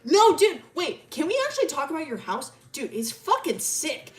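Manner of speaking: high, loud outburst; excited rambling. Retold or imitated. Milo gushing to Ellie about the house, excited rambling. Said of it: "he starts talking, like, really fast".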